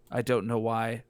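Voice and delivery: normal talking voice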